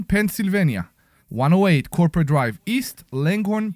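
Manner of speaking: normal talking voice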